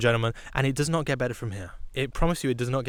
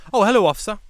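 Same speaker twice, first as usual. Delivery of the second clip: higher, louder hello to authority; polite greeting, re-enacted; 5 semitones higher, 5 dB louder, faster than usual. British driver greets the approaching officer, mock-innocent politeness. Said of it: "I go".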